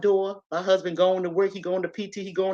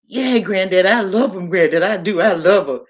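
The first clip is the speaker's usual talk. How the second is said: louder, insistent profession of love; emphatic declaration; 6 dB louder than usual. Retold or imitated. Granddaughter answering her grandfather, emphatic declaration. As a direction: insist on it to an elder. Acted earnest plea to grandfather.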